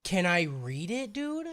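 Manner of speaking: drawn-out quiet asking; toned-down request. Real, not acted. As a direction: ask it softly and slowly, rising on dude. Same demand, suddenly toned down.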